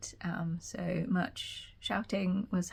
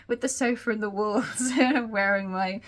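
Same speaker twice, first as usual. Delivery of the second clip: louder, laughing through the words; amused laughing; 3 semitones higher, 9 dB louder than usual. Real, not acted. Vlogger joking about matching her sofa, laughing. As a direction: let a chuckle break into the joke.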